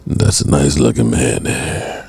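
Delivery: very low crooner impression. Shown as a demonstration. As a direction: drop right down to a deep soul-singer register.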